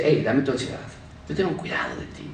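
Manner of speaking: God softly comforting an anxious listener, fading to a whisper. Acted. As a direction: hush it like a comforting father. God speaks tenderly.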